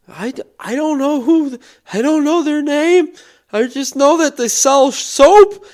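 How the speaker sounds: high, loud, frightened protest; panicked stranger. Retold or imitated. Stranger answers shouting homeowner, panicked and high. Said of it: "you could just see the fear".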